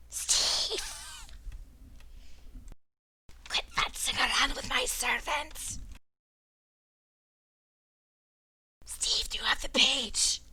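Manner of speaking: high whispered commanding voice; changed voice. Acted. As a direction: hiss orders in a strange whisper. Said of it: "El Conquistador's voice changes".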